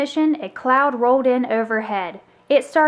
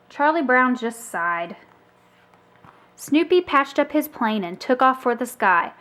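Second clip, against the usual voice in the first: ordinary delivery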